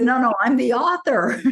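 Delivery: high, laughing insistence. Retold or imitated. To the stranger in the mall, laughing and high. Author revealing herself, laughing.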